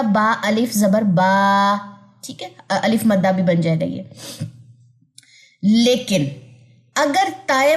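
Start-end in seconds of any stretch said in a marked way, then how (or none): 0.0-1.8 با stretched slowly to two counts; slow, stretched با for the pause; long alif on stop. Shown as a demonstration. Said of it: "کیا پڑھا جائے گا".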